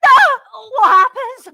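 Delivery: acted panic. Scared [name] hearing of camping trip, loud panic. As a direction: cry out in mock terror.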